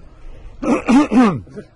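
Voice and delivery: loud high snorting hippo noise; hippo impression. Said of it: "My hippo impression".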